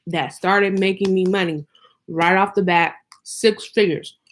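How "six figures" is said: talking while crunching ice; words around a mouthful of ice. Real, not acted. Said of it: "excuse me, I'm crunching".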